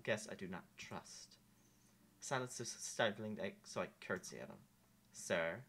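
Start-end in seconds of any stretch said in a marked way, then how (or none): none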